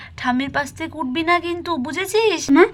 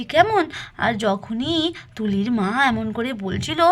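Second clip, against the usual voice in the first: plain reading voice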